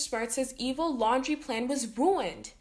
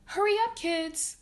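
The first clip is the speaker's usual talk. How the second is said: calling out; 9 semitones higher than usual. A narrator's reading. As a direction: urge the kids up the ladder. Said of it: "called their dad".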